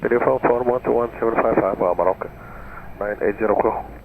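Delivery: crackly cockpit radio; pilot over the radio. Real, not acted. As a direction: read back over VHF radio. Pilot readback on tower frequency.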